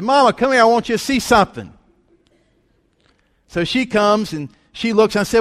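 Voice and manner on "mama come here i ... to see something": high, loud retold call across the house; yelling out to Mama. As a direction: call Mama over to come look. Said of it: "So I yell out to my mama".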